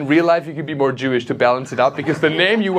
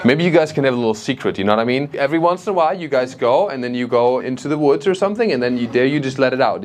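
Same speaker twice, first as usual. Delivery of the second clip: ordinary talk, nothing marked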